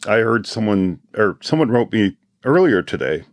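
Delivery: ordinary talk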